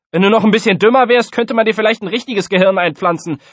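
high, loud schoolkid ribbing; mocking taunt. A narrator's reading. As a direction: rattle off the insult loudly. Pupil mocking his classmate.

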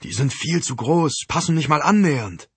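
high-pitched, let-down griping; disappointed complaint. A narrator's reading. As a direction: complain in dismay about a bad find. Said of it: "enttäuscht zog er die Stirn kraus".

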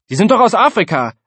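high, loud schoolkid retort; squabbling pupil setting a classmate straight; indignant bickering. A narrator's reading. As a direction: insist loudly, pitched right up.

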